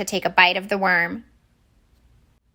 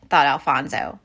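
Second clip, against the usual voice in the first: ordinary delivery, nothing marked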